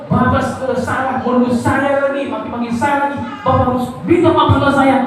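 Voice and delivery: high, demanding wife's voice; mock indignant wife. Wife demanding her husband apologise, high and indignant.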